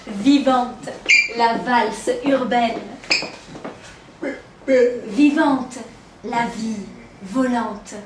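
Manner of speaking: slow, lilting poetry reading with pauses; poem recitation. Acted. Poet celebrating living, vibrant Paris, slow lyrical recitation. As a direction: draw out each phrase, falling on the repeated word.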